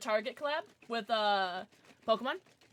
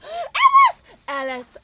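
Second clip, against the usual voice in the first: high gasped shout of a name; excited shout; 16 dB louder, slower than usual. Real, not acted. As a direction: shriek the name with delight.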